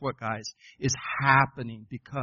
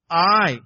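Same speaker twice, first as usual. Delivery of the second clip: I louder and higher, ringing out; emphatic I; 5 semitones higher, 10 dB louder than usual. Shown as a demonstration. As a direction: throw the word I out with force. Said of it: "emphatic in the Hebrew".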